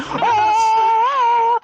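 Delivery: very high, drawn-out jungle call; high sustained "oh" yell; Tarzan yell. Retold or imitated. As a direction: imitate Tarzan's long yodelling cry. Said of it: "anytime Tarzan went".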